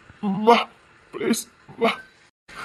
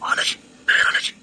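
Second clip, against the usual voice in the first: whisper; much slower than usual. Acted. Husband whispers compliments.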